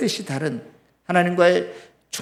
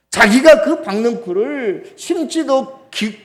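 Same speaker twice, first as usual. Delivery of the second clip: ordinary talk, nothing marked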